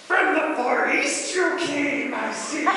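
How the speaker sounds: high, slow, loud Yoda with a laugh. Retold or imitated. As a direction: speak as a tiny Yoda-like Herod. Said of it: "this little Yoda".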